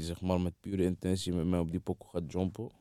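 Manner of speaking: usual speaking voice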